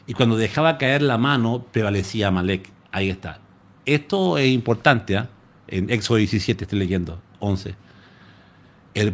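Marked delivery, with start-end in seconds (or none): none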